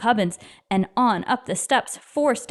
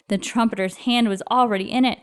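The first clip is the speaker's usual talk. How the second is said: plain reading voice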